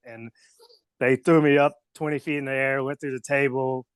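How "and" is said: ordinary talk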